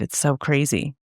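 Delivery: usual speaking voice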